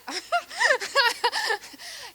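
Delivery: a quick high laugh breaking the sentence; amused high giggle at her own joke. Real, not acted. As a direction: giggle before finishing the thought. Laughs after joking about envy.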